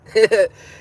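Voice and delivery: a big high burst of laughter; loud high laugh. Real, not acted. Laughing at his witch fantasy with a loud high burst. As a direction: crack up at your own joke.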